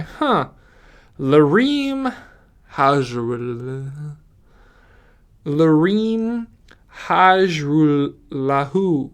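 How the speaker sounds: slowly sounding out Hajrullahu, syllable by syllable; halting, puzzled reading of a hard name; stumbling over a name. Real, not acted. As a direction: drag out Hajrullahu like a puzzled reader. Struggling to read kicker's name.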